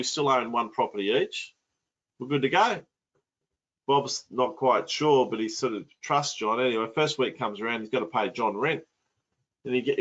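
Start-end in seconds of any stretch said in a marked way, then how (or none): none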